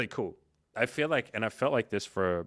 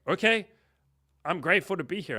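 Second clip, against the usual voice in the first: thrilled; 10 semitones higher, 7 dB louder than usual. Retold or imitated. Acted reply backstage to celebrities.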